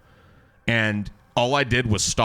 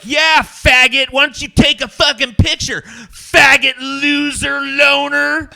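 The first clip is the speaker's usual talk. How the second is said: high loud taunting shouts; mock yelled insults; 11 dB louder, slower than usual. Anna Nicole yelling insults at the starer, angry mock shouts. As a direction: scream insults at him, mocking. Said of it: "She's like".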